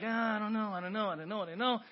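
high, hesitant nervous dithering; a believer too scared to approach. Acted. Bill at the throne, mock nervous dithering. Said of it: "He's like".

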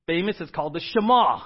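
normal talking voice

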